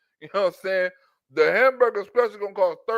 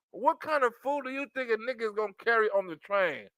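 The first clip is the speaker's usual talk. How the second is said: normal talking voice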